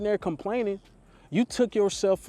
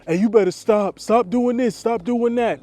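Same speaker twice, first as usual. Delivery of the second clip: loud, higher scolding shout; mock scolding; 5 semitones higher, 7 dB louder than usual. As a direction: bark it like a hater telling him off. Hater scolding him, quoted.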